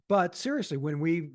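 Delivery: ordinary delivery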